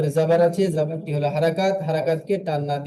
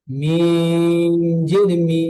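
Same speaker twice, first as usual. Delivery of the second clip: drawn-out syllable; 4 dB louder, much slower than usual. Shown as a demonstration. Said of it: "টান না দিয়ে".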